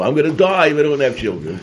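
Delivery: high, loud, fast mock desperation; talking like a woman. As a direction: imitate an overwrought woman's plea. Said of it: "she was talking like a woman".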